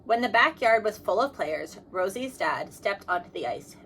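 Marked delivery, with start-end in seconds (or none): none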